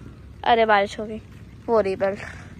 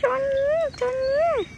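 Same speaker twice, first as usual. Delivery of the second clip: high sing-song call of a name; high sing-song call for the dog; 11 semitones higher, much slower than usual. Real, not acted. Calling the dog from afar.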